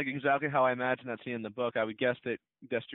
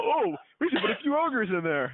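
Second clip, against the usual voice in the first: much higher, louder silly voice for the filmmakers; mocking the filmmakers; 10 semitones higher, 6 dB louder than usual. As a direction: ham up a clueless studio idea. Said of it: "they go".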